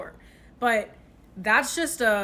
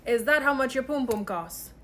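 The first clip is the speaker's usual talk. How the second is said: ordinary talk, nothing marked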